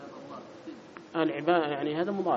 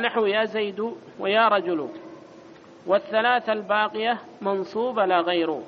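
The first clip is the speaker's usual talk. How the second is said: raised, louder recitation of the book; reading the text aloud; 5 semitones higher, 6 dB louder than usual. Acted. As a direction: read the passage out like a formal recital.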